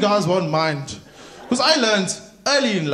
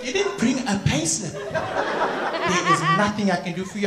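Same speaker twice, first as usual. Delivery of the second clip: officious bank teller impression; bank lady; 2 semitones higher, 4 dB quieter than usual. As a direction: turn the customer away primly. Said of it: "ladies and say to him".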